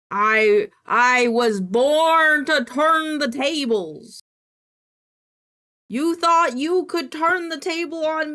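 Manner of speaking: loud, slow hammed-up shout; grand mock-evil declaration; mock villain boast. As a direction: boom it like a triumphant villain.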